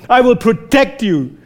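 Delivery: "I will protect you" said high and tender; God's warm promise. Retold or imitated. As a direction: vow it like a loving father. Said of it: "his posture is like this".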